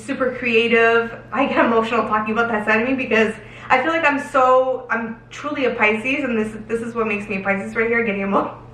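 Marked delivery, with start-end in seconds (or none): none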